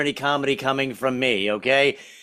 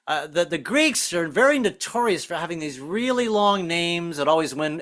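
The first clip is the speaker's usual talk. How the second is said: normal talking voice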